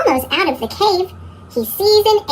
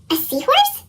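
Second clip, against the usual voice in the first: high squeaky cat voice; squeaky cartoon voice; 13 semitones higher than usual. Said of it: "says Pete".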